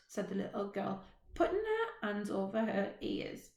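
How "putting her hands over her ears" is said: ordinary delivery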